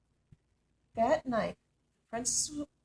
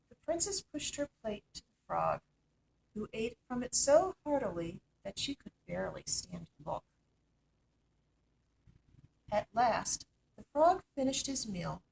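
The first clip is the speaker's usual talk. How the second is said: usual speaking voice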